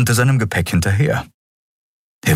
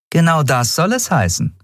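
higher female captain voice; captain's voice; 10 semitones higher than usual. Acted. Captain Nagun to Miko about no pay, higher voice. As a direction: say it plainly, a bit sorry.

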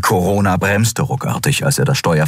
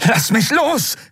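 much higher, desperate cry; struggling cry; slower than usual. A narrator's reading. Kai caught by the collar, high desperate cry. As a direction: wrench free, crying out.

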